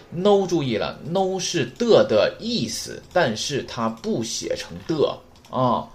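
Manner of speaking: slow, weighty teacher voice, each word laid down; slow deliberate classroom explanation; slow emphatic teaching. Shown as a demonstration. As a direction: spell it out slowly so it sticks. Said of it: "再说一遍".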